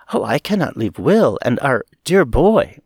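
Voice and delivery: plain reading voice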